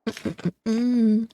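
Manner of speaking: humming through a mouthful; cracking sunflower seeds. Real, not acted. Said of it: "Sorry, I'm eating some sunflower seeds".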